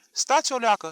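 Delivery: loud, high thin-voiced plea; thin voice rising. A narrator's reading. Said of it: "se înălța glasul subțire". (glas subțire care se înalță)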